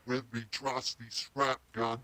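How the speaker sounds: low, soft pitch-shifted Australian character. Acted. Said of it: "we just pitched it down".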